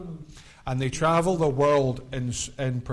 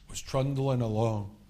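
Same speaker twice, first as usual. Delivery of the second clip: usual speaking voice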